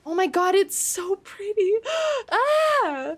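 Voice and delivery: high, breathless wonder; awed squeal. Real, not acted. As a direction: gush over the view. Awed by pretty scenery.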